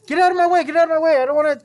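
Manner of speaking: high, loud shrieking; teacher acting out early kids in the way; mock panicked yell. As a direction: shoo them away in a fake panic.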